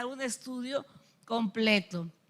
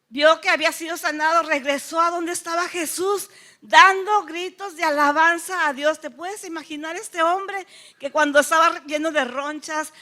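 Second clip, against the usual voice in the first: ordinary delivery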